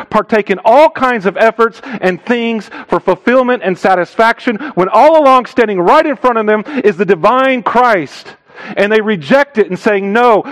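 high, driving sermon delivery; impassioned preaching. Real, not acted. Preaches with raised intensity.

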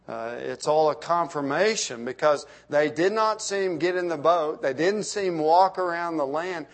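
ordinary talk, nothing marked

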